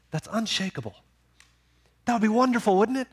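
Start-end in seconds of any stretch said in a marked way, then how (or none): 2.0-3.1 higher, eager exclaim on wonderful; eager exclamation. Acted. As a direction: lift the line up with wonder. Preacher exclaims then repeats.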